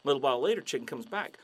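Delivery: ordinary talk, nothing marked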